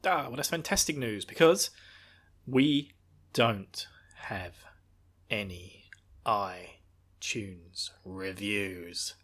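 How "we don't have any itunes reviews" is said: hushed, lower, slowing down; dramatic whisper. Pretend. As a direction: drop to a tragic hush. Dramatic pause, sinking voice.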